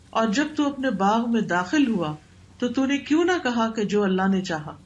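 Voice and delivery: ordinary talk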